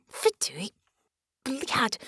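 emphatic little outburst; heartfelt cursing. A narrator's reading. Vicky swears at the returning enemy, with feeling. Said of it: "she said with feeling".

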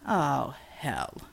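low, soft snarled curse. Acted. Matai sees the hostage and snarls low. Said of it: "Matai snarled".

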